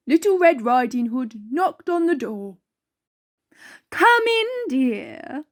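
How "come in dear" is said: wolf putting on a sugary, higher sweet voice; fake-kind wolf luring the girl in; wolf's nicest voice. A narrator's reading. Wolf greets the girl at the door in a falsely sweet voice. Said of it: "the wolf said in his nicest voice".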